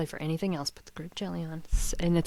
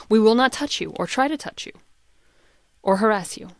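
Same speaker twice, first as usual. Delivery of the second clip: a touch louder, reassuring insistence; lightly voiced chef, raised voice; 4 semitones higher, 6 dB louder than usual. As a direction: chef promising not to touch the diner. Acting out chef in reel.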